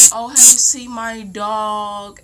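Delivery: loud, drawn-out mock inquiry; imitating dog owner. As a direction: stretch it out, mimicking an owner looking for a pet. Dog owner calling, voiced in story.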